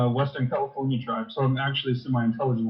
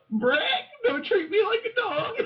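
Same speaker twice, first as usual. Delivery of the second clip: very high squawking parrot voice. Acted. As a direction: screech it like a parrot. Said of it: "in the parrot voice".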